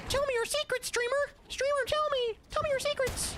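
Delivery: very high, strained falsetto demands; cartoonish high-pitched begging; screeching mock voice. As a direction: shriek it like a pest at the window. Escalating the mock pestering voice.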